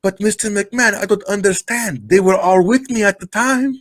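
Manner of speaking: very high, hammed-up accented bewilderment; badly faked foreign wrestler, pleading innocence; Iron Sheik. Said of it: "your Iron Sheik is awful".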